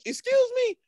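sharp, very high indignant question; mock outrage. Real, not acted. Scolding gatekeeper friend, very high mock outrage. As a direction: act scandalized.

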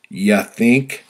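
loud, biting sarcasm; sarcastic shout. Retold or imitated. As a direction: snap it back sarcastically. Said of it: "I shouted sarcastically".

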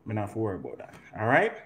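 ordinary talk, nothing marked

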